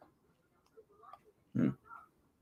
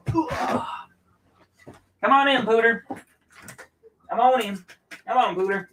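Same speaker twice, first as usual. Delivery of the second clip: high sing-song pet-calling voice; calling the dog; 11 semitones higher, 4 dB quieter than usual. Real, not acted. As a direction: coax the dog inside in a high coo. Letting the dog in.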